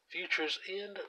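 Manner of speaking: ordinary talk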